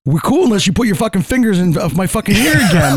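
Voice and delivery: half-joking threat with a laugh; laughing comeback. Retold or imitated. Answering the coworker asking if they're cool, laughing.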